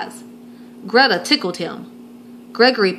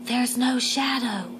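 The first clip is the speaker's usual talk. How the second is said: soft, slow whisper into an ear; 3 semitones higher, 8 dB quieter, slower than usual. Acted. Greta telling Gregory in his ear, hushed whisper. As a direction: breathe it secretly to him. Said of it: "Greta whispered into Gregory's ear".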